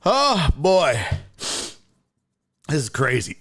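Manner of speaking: ordinary talk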